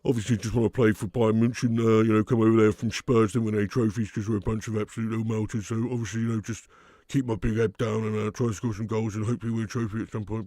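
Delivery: a lightly nasal footballer's voice, speaking through the nose; a hint of a nasal, droning player interview; Harry Kane nasal. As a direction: talk it all down the nose like a bored striker. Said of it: "let me just try and speak through my nose here".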